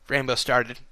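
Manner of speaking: usual speaking voice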